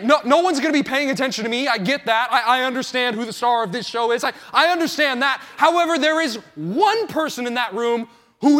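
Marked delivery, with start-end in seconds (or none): none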